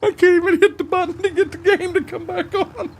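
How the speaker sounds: very high, squeaky laughing voice; real giggles choking every word; helpless laughter. Real, not acted. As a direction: struggle to speak through a laughing fit.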